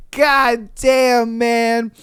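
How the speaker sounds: ordinary delivery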